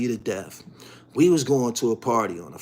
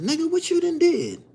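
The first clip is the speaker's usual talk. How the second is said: amazed director. Retold or imitated.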